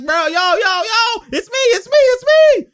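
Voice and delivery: high, frantic mock calling out; imitated pleading for the ball. Imitating Kemba asking for the pass, high frantic pleading. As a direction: beg for the pass, voice climbing very high. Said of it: "You can see Kemba like".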